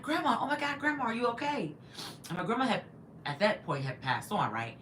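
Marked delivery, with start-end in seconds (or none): 0.0-1.7 higher-pitched, retold astonished concern; startled greeting. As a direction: call out to Grandma in mock disbelief. Retells her startled cry to dead grandmother.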